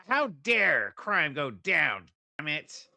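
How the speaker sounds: loud, drawn-out indignation; hammed-up fury, stretching the words; mock outrage. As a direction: play at being outraged. Mock outrage imitating debater's stance.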